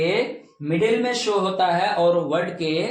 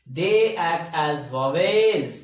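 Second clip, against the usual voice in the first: slow dictation; 3 semitones lower, much slower than usual. Shown as a demonstration. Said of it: "dictating rule slowly".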